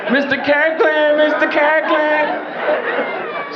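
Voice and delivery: high, very slow sing-song calling of a name; high calling women. Retold or imitated. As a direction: coo the name like adoring ladies.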